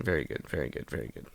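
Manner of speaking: deadpan fading praise; fading whisper. Acted. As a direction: whisper it down to nothing. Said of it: "fading deadpan whisper".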